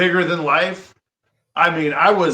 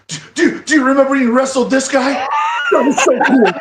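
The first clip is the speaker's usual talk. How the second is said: high, loud, breathless fan gushing; gushing fanboy; 9 semitones higher, 5 dB louder, faster than usual. Retold or imitated. Kid fan quizzing his wrestling heroes at dinner, loud high gushing. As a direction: gush like a starstruck kid meeting heroes. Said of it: "one thousand percent fanboy mode".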